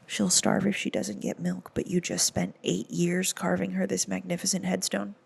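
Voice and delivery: quiet, dry sarcasm at the dad; deadpan sarcasm. Real, not acted. Teller mocking the father's logic, dry and quiet. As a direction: mutter it dryly. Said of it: "sarcastic aside to the father".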